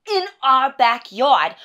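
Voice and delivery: loud, slow, outraged emphasis; indignant. Acted. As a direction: hit each word in disbelief. Wife ranting about fish burials.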